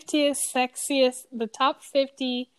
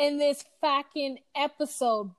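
hammed-up Boston accent; a rough Boston accent, clearly put on; 4 semitones higher, 4 dB louder than usual. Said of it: "Take us outta here on your Boston accent".

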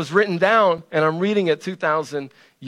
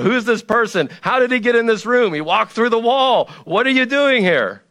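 higher, fast, frightened questions; mock panicked disciples; 7 semitones higher than usual. Disciples see Jesus walk in, higher mock panic. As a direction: play the disciples freaking out. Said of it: "They're freaking out".

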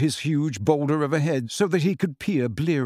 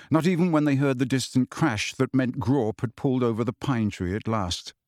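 ordinary delivery

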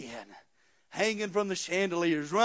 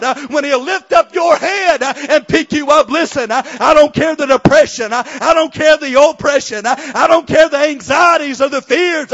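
loud, higher, chanted preaching with uh breaths; full-force preaching; 5 semitones higher, 13 dB louder than usual. Real, not acted. Preacher shouting to the congregation, loud and rhythmic. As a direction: build to a shouting climax, hammering each line.

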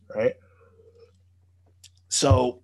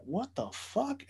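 baffled; 10 semitones higher, 9 dB quieter, slower than usual. Retold or imitated. Puzzled by the refusal.

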